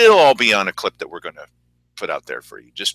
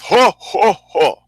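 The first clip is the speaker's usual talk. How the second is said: mock laugh in separate "ho"s; put-on, punched-out chuckle; Dave Toller laugh; 6 semitones higher, 4 dB louder, much slower than usual. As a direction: imitate a stagy, loud ho-ho-ho. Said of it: "it's the Dave Toller kind of laugh".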